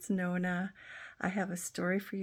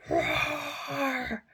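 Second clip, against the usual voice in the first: narrated roar; 8 semitones higher, 3 dB louder than usual. Acted. Bear begins to sing.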